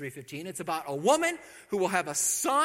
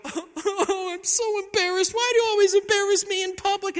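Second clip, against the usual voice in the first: much higher, loud whiny mock mom; embarrassed mom; 11 dB louder than usual. Mary imagined scolding Jesus in public, hammed-up whine. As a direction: whine it like a mortified mother. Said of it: "does she go".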